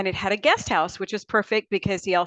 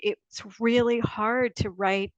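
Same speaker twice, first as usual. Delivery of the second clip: usual speaking voice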